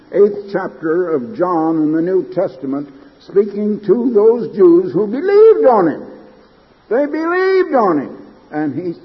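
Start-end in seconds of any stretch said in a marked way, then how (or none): 4.9-8.2 "believed" pitched high and drawn into emphasis; emphatic preaching. Real, not acted. Preacher hammers a scripture point.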